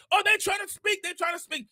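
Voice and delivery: imitated parental excitement; excited parent. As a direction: marvel at a baby's first sounds. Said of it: "we get excited over the goo".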